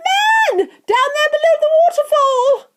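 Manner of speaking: very high, loud, excited shrieking; frantic scream. A narrator's reading. Veruca spotting a little man by the waterfall, frantic screaming. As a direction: scream each discovery in mounting astonishment. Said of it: "she screamed".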